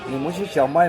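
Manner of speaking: normal talking voice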